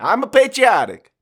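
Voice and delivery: put-on hammy Italian accent with a laugh. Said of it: "It just keeps morphing".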